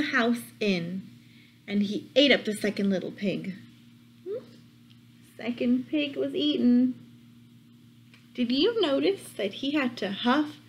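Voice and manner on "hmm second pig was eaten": plain reading voice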